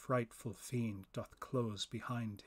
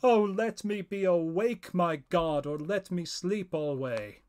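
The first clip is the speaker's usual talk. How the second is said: loud, higher desperate plea to God; impassioned raised prayer; pleading prayer; 5 semitones higher, 8 dB louder than usual. Acted. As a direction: cry out a prayer in fear of waking. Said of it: "I with sobs did pray".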